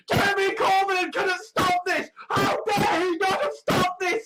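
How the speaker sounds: high-pitched, hammed-up ranting; mock venting. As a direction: vent in a high voice of mock outrage. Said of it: "sort of venting, going".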